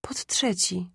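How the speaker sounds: whispered, barely voiced echo; weak repeat. A narrator's reading. Sick mother repeats the address, whispering weakly. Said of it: "powtórzyła matka".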